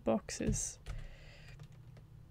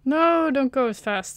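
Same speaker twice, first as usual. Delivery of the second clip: higher, louder burst of alarm; alarmed cry; 4 semitones higher, 7 dB louder than usual. Real, not acted. Player pleading with the game not to rush, alarmed. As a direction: call out in sudden panic at the game.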